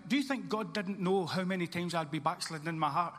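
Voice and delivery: ordinary delivery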